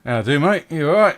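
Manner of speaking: cheery northern barkeep; hearty pub landlord greeting; barman voice. Acted.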